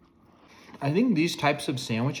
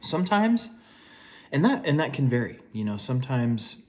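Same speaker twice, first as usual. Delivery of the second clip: ordinary talk, nothing marked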